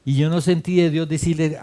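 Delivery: ordinary delivery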